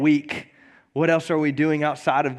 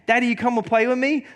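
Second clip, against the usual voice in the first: put-on higher pleading little girl voice; toddler voice; 6 semitones higher than usual. Daughter asking dad to play, high and pleading. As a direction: beg like a small child. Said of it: "she kept on asking me".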